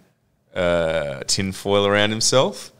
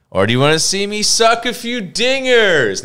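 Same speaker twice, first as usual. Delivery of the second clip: higher, louder showy offer; cocky player boast; 10 semitones higher, 4 dB louder than usual. Retold or imitated. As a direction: sell it like a hammed-up jock.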